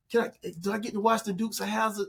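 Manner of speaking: put-on high, hopeful kid asking permission; lightly voiced pleading kid; pleading child. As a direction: ask like a little boy hoping for a yes. Child asking mom a favour.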